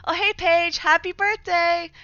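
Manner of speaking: high, loud, gushing birthday greeting; sweetly over-friendly, called out loud and high; fake polite. Student needling her bully Paige at the lockers, fake polite. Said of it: "you act real polite about it".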